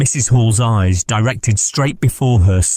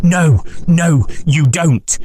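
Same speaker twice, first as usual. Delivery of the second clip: sharp cry; 8 semitones higher, slower than usual. Acted. Man crying out in a struggle, sharp and high. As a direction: cry out sharply as you grapple. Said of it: "a sharp cry of".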